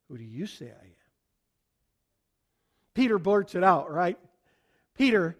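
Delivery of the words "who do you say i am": low and soft, almost under the breath; quiet searching question. Retold or imitated. Jesus looking his disciples in the eye, asking quietly. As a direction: ask it gently, eye to eye.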